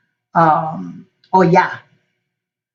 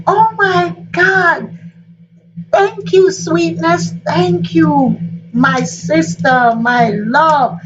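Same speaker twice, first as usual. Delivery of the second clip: much higher, slow, tearful thanks; a touch of tearful joy, much higher and slow; grateful relief; 9 semitones higher, much slower than usual. Acted. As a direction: melt into gratitude. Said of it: "And she was like".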